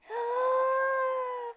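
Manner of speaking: a long high gasp of wonder; a drawn-out wide-eyed gasp; amazed gasp. Acted. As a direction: gasp at the treasure. They peek inside the chest.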